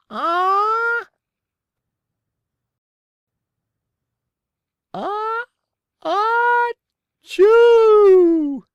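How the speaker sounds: high gasps, then a very loud sneeze; building sneeze. A narrator's reading. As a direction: wind up and explode in a sneeze. Richard's big sneeze.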